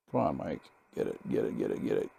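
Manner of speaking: will the needle to move under your breath; low murmured coaxing to self; coaxing mutter. Real, not acted.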